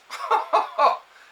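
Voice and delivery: a sharp, high burst of laughter; short incredulous laugh at the thought; scoffing laugh. Real, not acted. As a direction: laugh off the very idea of them. Scoffing at administrators.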